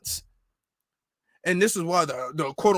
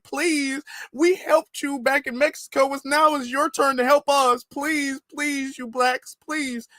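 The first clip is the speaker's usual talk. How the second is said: much higher, whiny mock plea; mocking pleading voice; 7 semitones higher, slower than usual. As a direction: parody a begging online video, pitched way up. Mocks crying clip.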